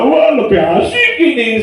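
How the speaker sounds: pleased husband voices his delight at the massage; happy. Retold or imitated. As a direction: gush happily like a pampered husband. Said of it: "sama seneng ngomongnya".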